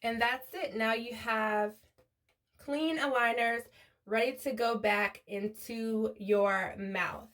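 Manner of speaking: quiet, even how-to narration; gentle tutorial voiceover, held well below normal level; soft voiceover. Acted. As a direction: talk viewers through the cleaning steps softly.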